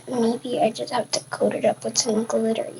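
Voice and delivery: soft whisper to herself. Real, not acted. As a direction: whisper it to yourself.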